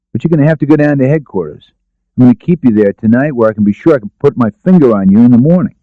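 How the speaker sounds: matter-of-fact arrest; lightly voiced lieutenant. Tragg telling Daphne she goes to headquarters, lightly firm.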